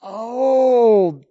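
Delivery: high, loud oh of discovery; a loud, drawn oh of understanding; realization. Retold or imitated. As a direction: cry out the sudden insight. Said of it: "And you go".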